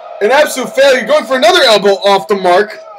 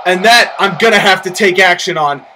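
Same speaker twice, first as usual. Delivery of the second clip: ordinary talk, nothing marked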